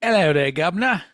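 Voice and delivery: hammed-up Cockney greeting; fake British accent. Co-host posing as absent Biff answers the call, hammed-up British accent. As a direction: put on a mock London accent. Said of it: "I don't know what accent I'm doing now".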